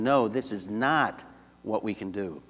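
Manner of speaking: slow, weighted NO and NOT, without raising the voice; emphatic refusal. Shown as a demonstration. Preacher explaining Paul's Greek double negative, slow emphatic refusal. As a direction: lean slowly and firmly on "No" and "not". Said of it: "It's a very emphatic way of saying".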